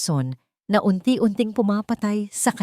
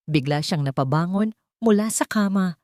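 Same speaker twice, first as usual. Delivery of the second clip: ordinary delivery, nothing marked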